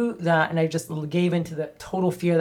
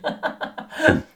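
laugh; 11 semitones higher than usual. Real, not acted. Laughs at own honesty.

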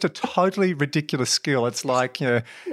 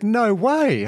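surprised; 9 semitones higher, 3 dB louder than usual. Retold or imitated. Amazed reply in retold scene.

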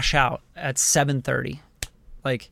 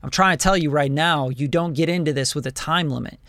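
normal talking voice

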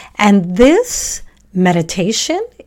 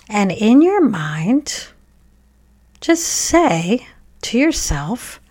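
guided meditation; 2 semitones higher than usual. Acted. Podcast host guiding children to thank heart, brain and body, guided meditation. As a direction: guide children gently through it, leaving room to breathe.

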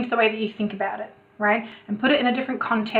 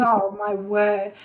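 drawn-out exasperation; much slower than usual. Retold or imitated. Exasperated at son's pedantry.

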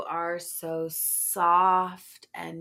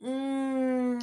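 sensual moan; 7 semitones higher, 5 dB louder than usual. Acted.

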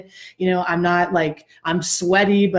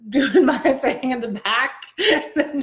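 amused, higher pitched talk; laughing; 5 semitones higher than usual. Real, not acted. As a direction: laugh through the words. Laughing recalling the back row.

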